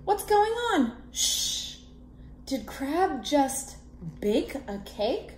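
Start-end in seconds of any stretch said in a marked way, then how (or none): 1.1-1.8 shushing. Acted. Hushing in story.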